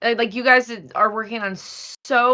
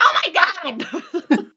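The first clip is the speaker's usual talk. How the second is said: high, loud, drawn-out retold squeal; excited outburst; 8 semitones higher, 6 dB louder, much slower than usual. Voice actress re-enacting hearing herself, thrilled. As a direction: stretch it out in loud, high amazement. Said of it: "I did the same thing as Happy".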